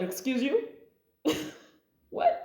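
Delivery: snippy excuse-me; indignant retort. Retold or imitated. Indignant reply to rude question.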